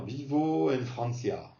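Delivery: vivo en Francia with a French accent; French accent in Spanish. Shown as a demonstration. Teacher mimicking most learners, Spanish with French accent. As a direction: say the Spanish as French people would. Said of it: "pour dire à la française".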